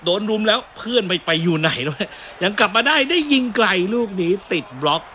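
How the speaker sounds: excited commentator calling a chance; live play-by-play. Real, not acted. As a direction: call the attack as it builds.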